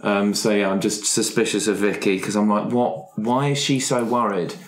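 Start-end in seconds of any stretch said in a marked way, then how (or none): none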